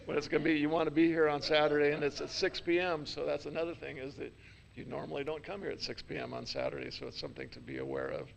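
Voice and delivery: ordinary talk, nothing marked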